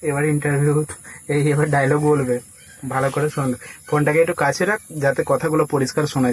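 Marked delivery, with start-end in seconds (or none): none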